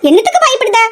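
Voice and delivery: high-pitched, exasperated question; scolding. Acted. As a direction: chide a frightened friend. Scolds frightened friend.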